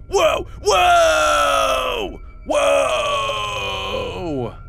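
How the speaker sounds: high, drawn-out wailing whoa; long whoas. Real, not acted. Flying through walls.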